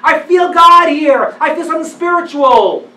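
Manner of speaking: awed excitement. Retold or imitated. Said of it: "and you say".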